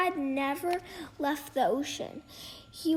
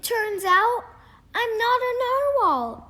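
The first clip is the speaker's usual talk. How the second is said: high and louder confession; nervous announcement; 8 semitones higher, 6 dB louder than usual. Acted. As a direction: blurt out the big news. Kelp tells friends his news.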